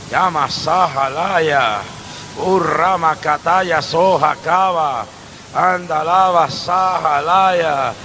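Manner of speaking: slow low tongues; speaking in tongues. Real, not acted. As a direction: murmur them slowly. Glosolalia tras oración. (lenguas lentas y graves)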